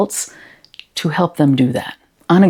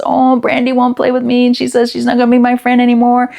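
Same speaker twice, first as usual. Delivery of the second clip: higher, lightly voiced child near tears; a hint of a crying child, pitched higher; upset little girl; 8 semitones higher than usual. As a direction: play the small girl complaining about her friend. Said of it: "She's crying".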